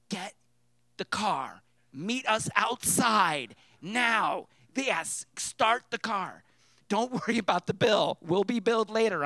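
loud, slow, punched-out commands; urgent orders. Retold or imitated. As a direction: order your brother out, word by word. Urgent orders to brother, retold.

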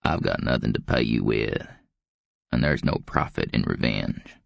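low, wary, croaky voice; aged, guarded growl; suspicious old man. A narrator's reading. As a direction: growl it low, distrusting the offer. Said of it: "Scarab looked at him suspiciously".

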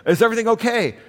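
put-on high, worried old mother on the phone; alarmed grandma. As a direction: gasp it out like a fretting grandmother. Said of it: "She said".

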